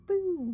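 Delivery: high, hammed-up ghost boo; playful boo. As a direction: spook them with a silly boo.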